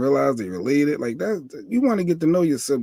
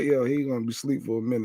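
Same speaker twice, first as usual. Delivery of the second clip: ordinary talk, nothing marked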